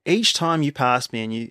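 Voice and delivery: usual speaking voice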